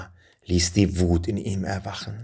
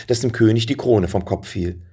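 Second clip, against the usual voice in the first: ordinary delivery, nothing marked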